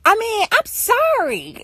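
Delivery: high, slow mock-sorry voice; imitated parent apology. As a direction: mimic a parent's drawn-out apology. Voicing parent's insincere apology.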